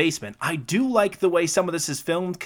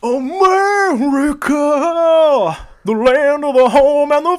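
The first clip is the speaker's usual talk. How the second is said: higher and louder, hammed-up America anthem voice; grand mock-patriotic announcer, much louder; mock patriotic proclamation; 13 semitones higher, 9 dB louder than usual. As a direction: belt it like a parody of a flag-waving ad.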